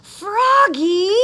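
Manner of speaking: high, loud friendly yell. Acted. Max yelling to Froggy on the bus, loud call. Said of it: "yelled his friend Max".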